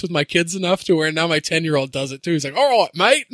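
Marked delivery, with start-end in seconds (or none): 2.5-3.3 a light mock Australian accent; mock Australian greeting; kid's Aussie impression. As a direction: kid doing a bit of Aussie. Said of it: "I talk in that, like, voice".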